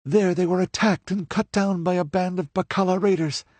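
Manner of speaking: put-on high young woman's voice, rushing at first; hurrying through her story before she is missed; hurried telling. A narrator's reading. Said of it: "she continued hurriedly".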